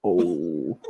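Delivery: normal talking voice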